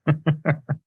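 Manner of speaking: short high laugh at himself; real chuckle at his own accent. Real, not acted.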